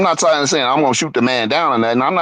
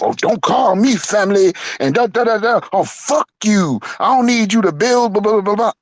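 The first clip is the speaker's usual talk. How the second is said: higher, hammed-up furious outburst; mock angry rant; 8 semitones higher than usual. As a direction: mimic him losing it and cursing. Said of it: "Oh, he went off".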